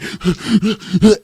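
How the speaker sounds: loud, high mock gasps; angry huffing, imitated. Gym rivals angrily working out harder, imitated huffing. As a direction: huff hard like furious men straining. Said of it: "got so fucking angry".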